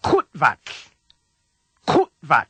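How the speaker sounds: Kutvat with harsh k, mid-word stop, whispered tl ending; Klingon pronunciation. Shown as a demonstration. Teacher gives the full word to repeat, Kutvat harsh and clipped. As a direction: cut Kutvat short and end it in a hissed tl. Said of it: "say the full word".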